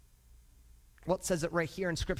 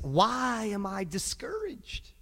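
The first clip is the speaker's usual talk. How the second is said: slow, drawn-out sad question; slow plaintive scripture reading; discouraged lament; much slower than usual. Acted. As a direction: stretch it out, wondering and downcast. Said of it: "it says it like this".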